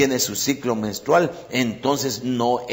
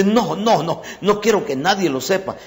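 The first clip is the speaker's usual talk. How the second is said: insistent refusal; 6 semitones higher than usual. Retold or imitated. Counselee refusing, quoted in sermon.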